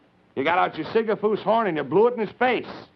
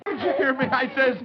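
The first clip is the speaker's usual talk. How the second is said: excited protest; 10 semitones higher, 4 dB louder than usual. Real, not acted. Host squirted, protesting.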